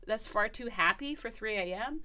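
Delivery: ordinary delivery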